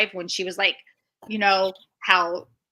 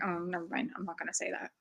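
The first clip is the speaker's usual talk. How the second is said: muttered backing off; 14 dB quieter, faster than usual. Real, not acted.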